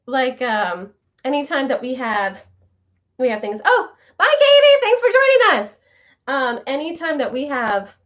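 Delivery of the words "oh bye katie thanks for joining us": high, loud, bright farewell call; excited goodbye. Real, not acted. Waving off Katie as she leaves the stream, loud cheerful goodbye. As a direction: sing out the goodbye as a friend leaves. Calling goodbye to departing viewer.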